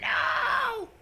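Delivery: high, hammed-up cry of no; mock horror no. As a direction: wail it like a horror victim.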